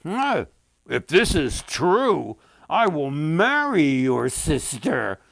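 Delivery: loud, slow royal decree; the king. A narrator's reading. The king answers the brother's plea, loud and commanding. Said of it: "the king said".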